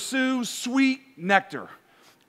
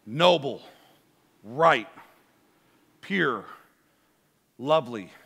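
scripture reading; 7 semitones lower than usual. Acted. Reads a scripture passage aloud.

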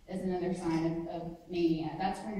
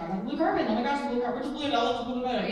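loud, rushed manic chatter about carpet; pressurized speech; 4 semitones higher, 7 dB louder, faster than usual. Shown as a demonstration. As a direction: spill every thought at once. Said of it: "do a good impression of that".

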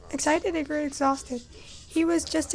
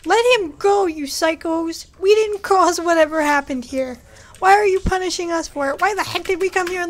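loud, angry shouting at captors; furious yelling; 5 dB louder than usual. Real, not acted. Player yelling at the game's captors, furious. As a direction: yell at them to let him go. Captors torturing his friend Jonah.

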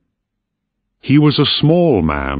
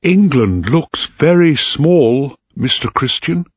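put-on higher, lifted young voice; mildly excited, pitched up; excited young officer; 7 semitones higher than usual. A narrator's reading. Young officer remarks to his superior at sea, excited. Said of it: "and excited".

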